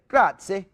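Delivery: Grazie said the Italian way, rolled and crisp; Italian word said. Shown as a demonstration. Guest explaining Italian thanks, Grazie in Italian style. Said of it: "in Italy, you say".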